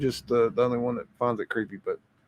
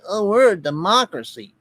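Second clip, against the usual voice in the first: mocking quote; 7 semitones higher, 5 dB louder than usual. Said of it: "They say".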